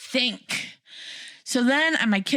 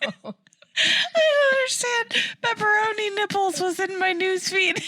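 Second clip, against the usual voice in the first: much higher, laughing through the words; laughing disbelief; 12 semitones higher than usual. Real, not acted. Laughing at what showed up in her feed. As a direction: crack up as you say it.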